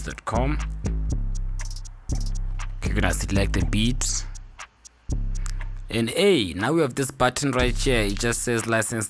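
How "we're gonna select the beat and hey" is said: ordinary talk, nothing marked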